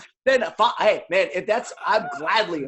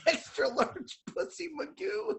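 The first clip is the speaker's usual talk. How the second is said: laughing; 9 semitones higher, 9 dB quieter, much slower than usual. Real, not acted. Repeats nickname laughing.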